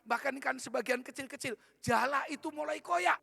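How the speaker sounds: impassioned pulpit climax; fervent preaching. Real, not acted. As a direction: pull the whole church up with you. Preacher lifts to a new climax.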